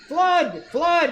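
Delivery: high loud alarm cry; shrill-pitched flood warning. A narrator's reading. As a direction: yell the warning to scare them. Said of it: "he cried".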